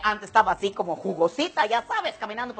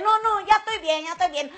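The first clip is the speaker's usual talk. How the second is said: high-pitched, hurried protest; squeaky mock granddaughter declining; polite refusal; 10 semitones higher than usual. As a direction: wave off more food in a high voice. Granddaughter refusing more food.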